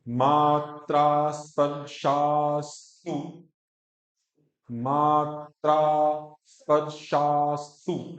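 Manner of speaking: मात्रा स्पर्शास्तु slow, each syllable held on an even note; half r. Shown as a demonstration. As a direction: chant मात्रा स्पर्शास्तु with r clipped onto sha. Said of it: "रेख भी आधा ही बोलना है".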